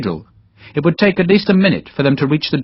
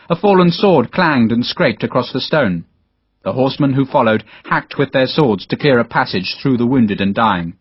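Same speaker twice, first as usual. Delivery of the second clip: ordinary delivery, nothing marked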